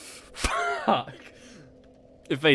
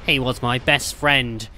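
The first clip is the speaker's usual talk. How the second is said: usual speaking voice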